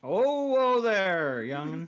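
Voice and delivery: slow, drawn-out frontier greeting; a hammed-up old-timer hailing a youngster; old cowboy. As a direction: greet the kid like an old prospector.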